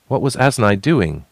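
ordinary delivery, nothing marked

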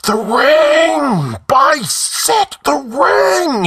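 high, loud frantic shriek. A narrator's reading. The slave sees his ring, shrieking. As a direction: shriek it out in a wild cry. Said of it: "he shrieked".

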